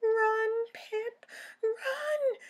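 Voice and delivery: high, loud panicked shout; frightened cry. Acted. Daisy crying out to Pip to flee, loud. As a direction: yell it in a panic. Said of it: "cried Daisy".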